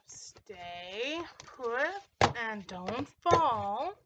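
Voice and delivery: very drawn-out, swooping words; slow, sing-song order to an object; coaxing command. Real, not acted. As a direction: stretch each word out slowly as you coax. Pleading with a falling DVD case.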